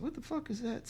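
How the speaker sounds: soft, high-pitched baffled question; puzzled disgust. Retold or imitated. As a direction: ask it small and high, peering closely. Finds odd red spot on road.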